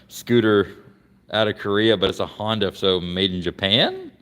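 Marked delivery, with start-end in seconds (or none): 2.7-4.1 Japan drawn out with the pitch swooping up; slow, with an unsure upward lift on Japan; rising questioning tone. Real, not acted. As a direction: let Japan climb into a doubtful question. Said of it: "how my voice went up there".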